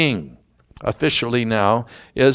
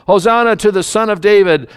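raised, higher praise, moderately loud; crying out; 7 semitones higher, 6 dB louder than usual. Acted. Children hailing the Son of David in the temple, crying out. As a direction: cry it out with lifted pitch. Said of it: "the children crying out in the temple".

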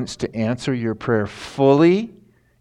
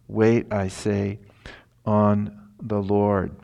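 slow and drawn out, "Wait" stressed gently; slow, deliberate repeat; slower than usual. Shown as a demonstration. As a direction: stretch every word of "Wait, I say, on the Lord". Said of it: "a double emphasis here".

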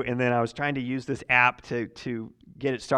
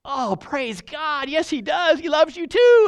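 much higher, slow, gushing praise; joyful response; 13 semitones higher, 9 dB louder, slower than usual. Retold or imitated. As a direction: imitate a delighted believer. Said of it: "Sometimes you get".

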